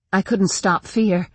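plain reading voice

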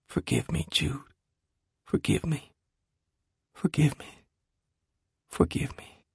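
tearful whisper. Acted. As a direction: barely breathe the words. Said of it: "he whispered the words aloud, but quietly".

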